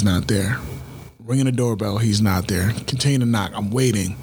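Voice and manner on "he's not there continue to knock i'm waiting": ordinary delivery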